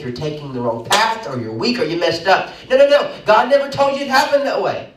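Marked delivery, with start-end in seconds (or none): 2.7-3.1 high, loud triple no; emphatic no. Real, not acted. Preacher to congregation, loud emphatic no. Preacher rejecting a wrong belief. 3.2-4.9 high and loud, driving every word; preaching at full force. Real, not acted. Preacher correcting his congregation, loud and high. Repeated line, first take loud.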